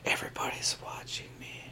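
nervous breathy whisper. Retold or imitated. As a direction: whisper it paranoid. Unvoiced whisper.

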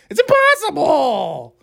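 high, stretched-out mocking squeal; squeaky Tucker Carlson. Podcaster slipping into a TV host parody, squeaky. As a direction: push it way up into a squeaky whine. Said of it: "sound like Tucker Carlson".

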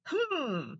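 normal talking voice

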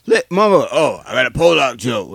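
high put-on voice of a joker; lightly voiced kid telling a joke; mocking joker voice.

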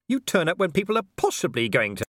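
high, incredulous questioning; indignant disbelief. Acted. Vimes challenges Death's reason for coming, high disbelief. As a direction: push the pitch up in disbelief.

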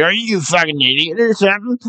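lightly mocked gruff scold; imitating Chuck. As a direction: boss scolding the new guy. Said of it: "He's like".

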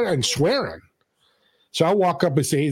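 ordinary delivery

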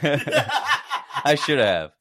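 real laugh running into slow words. Real, not acted. Teased friend agreeing he should have tried harder, laughing. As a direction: concede it through laughter.

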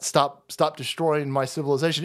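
ordinary talk, nothing marked

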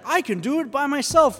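cocky mock boast, pitched high; prideful. Preacher mocking our idea of self-reliance, prideful. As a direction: imitate someone arrogantly insisting on their own strength. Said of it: "prideful or arrogant in the idea".

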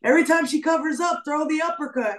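high, quick imitation of a cornerman; corner coach calling out. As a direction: call out instructions like a fight corner. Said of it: "I heard her corner saying".

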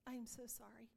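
hushed, tender apology; soft sympathy. Retold or imitated. To sick friend Sherry, soft tender sympathy. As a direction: comfort her gently. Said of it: "I was like".

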